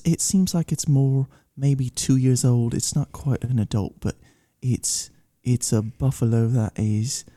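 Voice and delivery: measured, halting mock British accent. Cigar reviewer describing subtle notes, calm British accent. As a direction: pause over each note like a British connoisseur. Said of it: "you sounded just like [name]".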